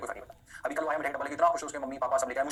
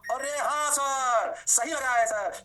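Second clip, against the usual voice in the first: mock student; 7 semitones higher, 4 dB louder, much slower than usual. Teacher voicing impressed student.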